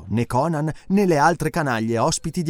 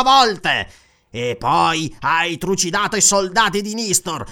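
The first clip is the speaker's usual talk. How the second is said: gloating magistrate; 7 semitones higher than usual. Acted. Magistrate accusing the cornered Conan, pompous and gloating. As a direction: pile on the charges, relishing it.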